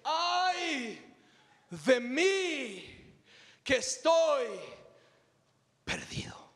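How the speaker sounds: a hammed-up cry of doom fading to a gasp; Mexican grito cry. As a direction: wail it like a desperate Mexican grito, then collapse. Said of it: "Imagínate este grito en un mexicano".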